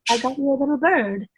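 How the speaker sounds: lightly voiced cat presenting its catch; proud pet voice. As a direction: play the cat dropping a bird at your feet, pleased. Cat bringing a gift, mock voice.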